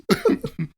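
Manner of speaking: high giggle. Real, not acted.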